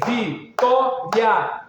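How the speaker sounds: "vitória" in three slow beats, vi-tó-ria; syllable by syllable. Shown as a demonstration. Teacher demonstrating sound emissions, "vitória" beat by beat. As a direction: split "vitória" slowly, keeping ria as one beat. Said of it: "uma emissão sonora".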